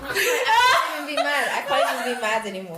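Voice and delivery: laughing through the words; giggling in disbelief at a terrible roll. Real, not acted. Player laughing at bad roll.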